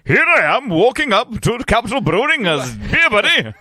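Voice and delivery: high, loud hammed-up voice; mock self. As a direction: ham up a swaggering arrival. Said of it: "And going".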